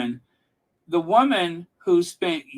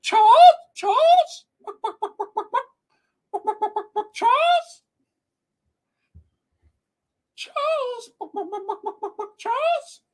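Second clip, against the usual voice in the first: very high, loud squawking chicken calling Charles; hammed-up crying hen, shrieking and fading; mocking chicken clucking; 8 dB louder than usual. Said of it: "She was like".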